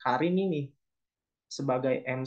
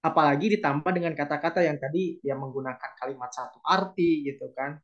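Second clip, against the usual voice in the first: normal talking voice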